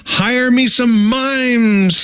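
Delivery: slow, high, drawn-out royal command; a hint of a thundering king, stretched out slowly; mild thunder. King thundering his order at the court, lightly voiced. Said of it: "he thundered".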